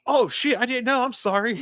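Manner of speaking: high-pitched, flustered mock apology; hammed-up apology. Gilmore apologizing to the kid caddy, high and flustered. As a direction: backpedal in a high voice.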